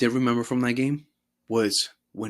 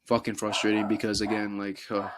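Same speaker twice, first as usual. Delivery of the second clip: usual speaking voice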